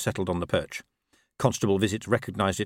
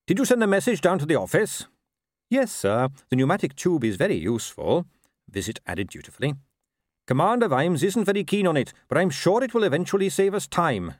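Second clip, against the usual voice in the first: higher, earnest captain; Carrot voice; 9 semitones higher, 3 dB louder than usual. A narrator's reading. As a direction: play Carrot bright and sincere. Carrot's lines.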